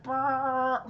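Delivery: buzzing brass imitation; imitated trumpet blare. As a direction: blare out a mock fanfare note. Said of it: "with trumpet music".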